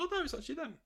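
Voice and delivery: an imitation of the cook's amazed realization; surprised cook.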